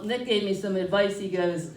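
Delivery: usual speaking voice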